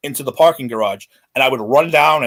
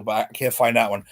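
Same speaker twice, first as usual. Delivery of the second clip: normal talking voice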